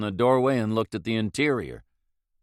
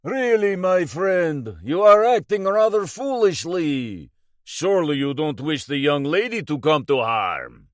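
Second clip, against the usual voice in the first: higher, louder, silky menace; a villain playing polite over a loudhailer; smooth Russian villain; 7 semitones higher, 6 dB louder than usual. A narrator's reading. Kossoff taunting the captain through a loudhailer.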